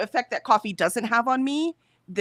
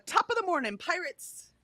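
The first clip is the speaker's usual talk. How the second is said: lilting Irish greeting, pitched up at the start; Irish pirate; 8 semitones higher than usual. Acted. Host greeting chat as an Irish pirate, Irish accent. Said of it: "He's an Irish pirate".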